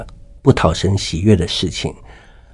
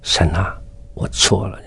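soft, much quieter, broken confession to God; sincere hushed confession; 3 semitones lower, 6 dB quieter than usual. Retold or imitated. A man in hospital confessing to God, soft and contrite. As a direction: whisper it like a guilty child. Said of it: "我就很诚心的跟他讲说".

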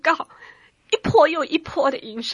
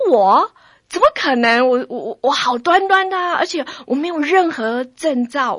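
shocked protest; stunned disbelief; 3 semitones higher, 9 dB louder than usual. Retold or imitated. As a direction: blurt it out as if the news can't be true. Disbelief at the diagnosis.

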